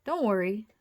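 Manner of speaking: ordinary delivery, nothing marked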